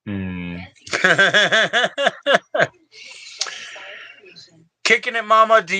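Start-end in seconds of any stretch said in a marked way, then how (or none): 0.9-2.7 high staccato giggle. Real, not acted. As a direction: break into a long high chuckle. Laughs after reminiscing.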